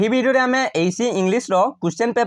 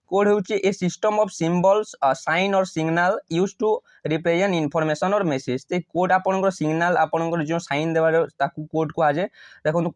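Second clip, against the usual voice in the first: ordinary talk, nothing marked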